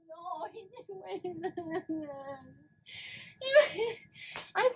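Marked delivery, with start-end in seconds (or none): 0.1-1.5 much softer, slow disbelief; stunned murmur. Real, not acted. Shocked viewer murmuring in disbelief. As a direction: barely get it out.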